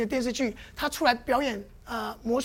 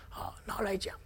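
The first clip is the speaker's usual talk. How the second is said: whispered aside; 6 semitones lower, 12 dB quieter than usual. Real, not acted.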